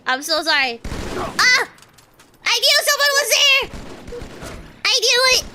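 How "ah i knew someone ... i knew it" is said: high, loud cry of alarm; startled shouting. Real, not acted. Gamer ambushed by someone they suspected, startled shouting. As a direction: yell it, pitch shooting up.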